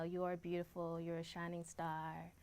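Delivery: usual speaking voice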